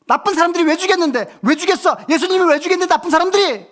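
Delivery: high, loud, relentless questioning; mock grilling shout. Dad cornering his nearly crying son, relentless mock shouting. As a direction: badger them as if driving them into a corner. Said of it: "막 코너로 막 몰고 갔지".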